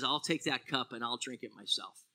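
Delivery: quiet resolve. Retold or imitated. Jesus takes the cup, quietly.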